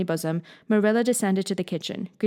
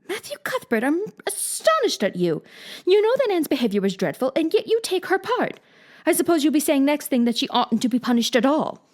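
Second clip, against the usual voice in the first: put-on high, indignant old woman's voice; astonished Marilla; 8 semitones higher than usual. A narrator's reading. Marilla scolding Matthew, astonished. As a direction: scold him in disbelief. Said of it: "Marilla astonished".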